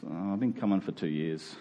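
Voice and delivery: ordinary talk, nothing marked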